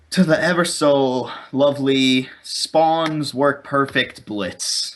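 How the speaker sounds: slow, drawn-out sarcasm; real sarcasm, dragging each word out; sarcastic. Real, not acted. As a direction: stretch out the mock praise, dripping with sarcasm. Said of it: "cannot sense the sarcasm in [name]'s voice".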